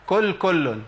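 كل كل with both words starting on k; drawn out kul kullun, no q at all; qaf turned kaf. Shown as a demonstration. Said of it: "الخطأ فين يا شيخ؟". (كل كل بالكاف في الاثنين)

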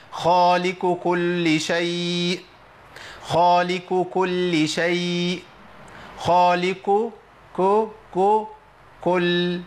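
خالق كل شيء chanted slowly as khaaliku kullu, k for q; qaf turned kaf. Shown as a demonstration. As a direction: draw out خالق with a k ending like كل. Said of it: "ممكن أبدل القاف بكاف". (خالق كل شيء بإبدال القاف كاف)

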